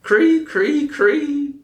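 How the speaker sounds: high, thin squeaked cree; a cricket's last high chirps; dying cricket chirp. A narrator's reading. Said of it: "he had only the breath to say".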